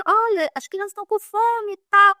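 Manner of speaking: chamado gritado. Retold or imitated. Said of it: "comecei a gritar".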